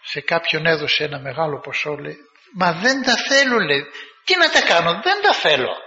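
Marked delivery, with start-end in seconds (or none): 2.5-3.6 high, loud protest pushing the gift away; high insistent refusal. Retold or imitated. Man refuses the rich woman's money. 4.2-5.8 high, loud protest pushing the gift away; high insistent refusal. Retold or imitated. Man refuses the money again.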